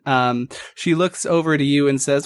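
usual speaking voice

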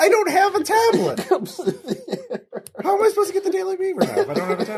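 exasperated whine. Real, not acted. Pleading for a tablet.

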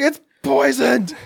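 high, drawn-out hammed-up alarm; mock panic. Joyce warning party guests about the cake, mock-panicked cry. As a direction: stretch it out like a frantic warning.

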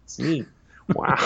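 laid-back Shakespeare with dreamy wows; lightly voiced Owen Wilson reciting; Owen Wilson doing Shakespeare. Owen Wilson recites Macbeth, laid-back and dreamy. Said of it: "the guy could do Shakespeare".

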